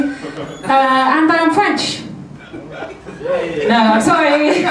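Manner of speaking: usual speaking voice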